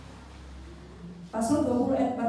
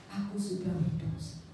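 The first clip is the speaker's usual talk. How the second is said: humble confession; 4 semitones lower, 7 dB quieter than usual. Acted.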